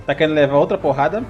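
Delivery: ordinary talk, nothing marked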